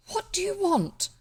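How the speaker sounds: high squeaky frightened voice. A narrator's reading. Cousin Bet faces the highwayman, squeaky and high. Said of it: "Cousin Bet's voice was quite squeaky".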